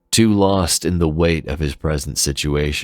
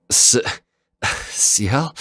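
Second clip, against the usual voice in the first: a whispered stammer rising to a higher, frightened question; terrified; 8 semitones higher, much slower than usual. Acted. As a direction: barely get her name out in fear. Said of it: "he managed to ask, terrified".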